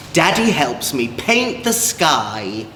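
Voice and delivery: plain reading voice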